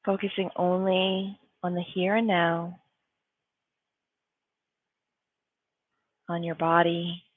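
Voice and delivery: calm, spacious delivery with long pauses; guided meditation. Real, not acted. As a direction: guide them into stillness, phrase by phrase.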